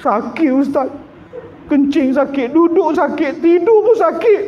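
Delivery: whining complaint. Retold or imitated. Caller complaining to the ustaz, rising pained whine. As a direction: whine about the pain.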